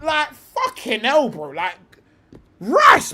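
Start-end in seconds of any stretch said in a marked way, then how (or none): 0.0-1.3 a high, drawn-out yell of frustration; exasperated shout. Real, not acted. Streamer yelling at a footballer to rest, frustrated shout. 2.6-3.1 a sudden, high, loud cry of the name; calling out. Real, not acted. Streamer shouting a footballer's name in frustration.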